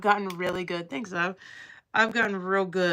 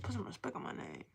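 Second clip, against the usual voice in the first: much softer, hushed rapper line; mock rapper quote; 2 semitones higher, 13 dB quieter than usual. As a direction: tease a cousin, murmuring the line. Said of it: "Be like".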